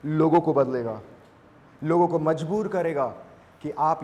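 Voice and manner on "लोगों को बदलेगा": normal talking voice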